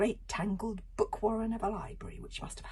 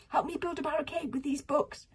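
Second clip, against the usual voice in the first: shouting; 9 semitones higher than usual. Acted. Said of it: "shouted Kamikaze".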